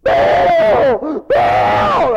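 hammed-up frenzied shout; screaming. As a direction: scream out to a god at full volume. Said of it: "jumping up and down and screaming".

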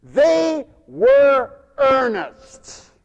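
very slow, loud and high; emphatic proclamation. Acted. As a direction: hammer out each word at full force. Preacher hammers the point.

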